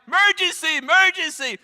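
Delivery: very high, loud panicked shout; frantic dad yelling. Retold or imitated. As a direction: shout for help at the top of your range. Craig yells for his wife.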